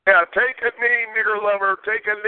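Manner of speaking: high put-on falsetto, jeering; sing-song troll heckle in falsetto; mocking falsetto taunt. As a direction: taunt the room in a squeaky fake voice. Troll taunting the chat line.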